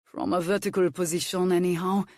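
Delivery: plain reading voice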